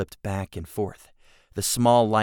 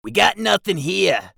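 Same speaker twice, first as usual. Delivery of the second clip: goblin voice; 12 semitones higher, 8 dB louder than usual. Acted. Jigsaw reports.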